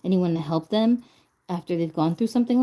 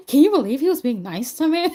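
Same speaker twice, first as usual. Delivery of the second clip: higher, louder, theatrical amazement; mock disbelief; 7 semitones higher, 5 dB louder than usual. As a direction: overact the surprise for laughs. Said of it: "I shouldn't be like".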